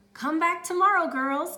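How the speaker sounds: high, loud grin; grinning. Acted. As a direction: beam at them. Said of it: "grinned Mister Lamb".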